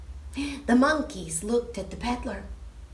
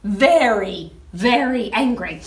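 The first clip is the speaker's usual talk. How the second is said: ordinary delivery, nothing marked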